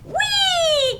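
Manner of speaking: very high, squealing frog "Wee"; frog's happy cry. Acted. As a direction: let the frog squeal with joy. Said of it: "Frog went".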